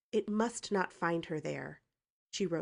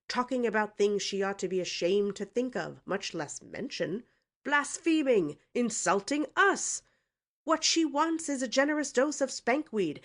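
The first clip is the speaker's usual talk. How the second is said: indignant rant; 5 semitones higher, 7 dB louder than usual. A narrator's reading. Uncle ranting about niece's insults.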